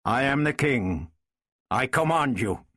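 firm, commanding king; authoritative. A narrator's reading. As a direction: lay down the order with measured authority. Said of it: "a new note of authority entering his voice".

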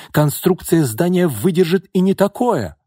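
high, loud, heated insistence; defiant exclamation. A narrator's reading. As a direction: shout down the critic. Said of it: "воскликнул Яков". (запальчиво воскликнуть)

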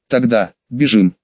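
ordinary delivery, nothing marked

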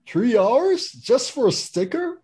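can't believe it, rising up; incredulous outrage. Real, not acted. Outraged at requirements.

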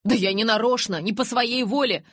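loud, high-pitched self-defence; insistent protest. A narrator's reading. Jacob resists Bella's accusation while backing away, insistent protest. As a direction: protest your innocence urgently. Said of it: "упирался Джейкоб".

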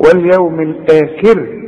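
«الآخر» براء رقيقة وشفاه مبتسمة; ابتسم وأنت ترقق «الآخر»; ترقيق بابتسامة. Shown as a demonstration. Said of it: "رجع شفايفك على هيئة ابتسامة". («واليوم الآخر» بكسرة وراء مرققتين)

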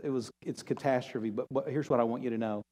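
soft, low, hushed comfort; quiet promise that it's handled; tender reassurance. Acted. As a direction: speak gently to a hurting child. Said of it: "And God's saying".

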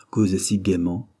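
plain reading voice

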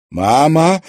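loud startled cry of mama; a high, loud surprised call; exclaiming. A narrator's reading. Daughter catching her mother on her feet, loud startled cry. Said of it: "воскликнула Луиза".

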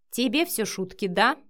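usual speaking voice

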